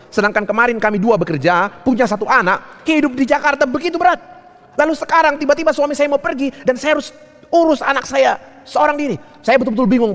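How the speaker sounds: high-pitched plea of an overwhelmed wife; imitated anguish, urgent and high; acted distressed plea. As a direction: pour out the burden, voice climbing high. Retold wife's anguished plea to pastor.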